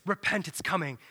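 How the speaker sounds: low, hushed call to repent; low quiet prophet voice; prophet's voice. Acted. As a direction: murmur it like a far-off prophet. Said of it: "there's a voice".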